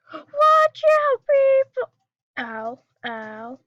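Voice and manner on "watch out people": high, loud cry of alarm; yelling warning. Real, not acted. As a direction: shout a warning to everyone. Ship under attack, yelling warning.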